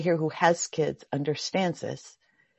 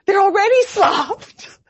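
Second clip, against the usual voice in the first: put-on high, slow child voice; granddaughter's high drawn-out reply during meditation; little girl voice; 12 semitones higher, 8 dB louder than usual. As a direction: drag it out like a sleepy-cute kid. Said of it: "She goes".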